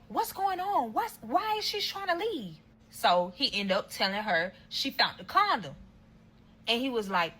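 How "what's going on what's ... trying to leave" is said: voicing his mom. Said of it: "She's like".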